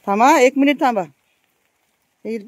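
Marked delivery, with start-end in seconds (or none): 0.1-1.0 loud call to someone nearby; calling out to wait. Real, not acted. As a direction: raise your voice to stop him. Asking the man to wait. (मोठ्याने थांबा म्हणून हाक)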